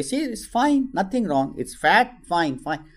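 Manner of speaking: reassuring mother. Acted. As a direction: show the child it's fine, gently. Mother reassuring child with glass.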